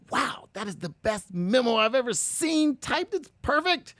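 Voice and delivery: impressed boss. Retold or imitated. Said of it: "your boss goes".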